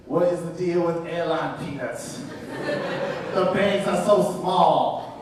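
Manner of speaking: slow, slurred stoner comic drawl; slow, laughing slurry comic impression; drawn-out, hazy deadpan comic delivery; drunk Mitch Hedberg. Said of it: "I'm gonna do it with Mitch Hedberg's voice".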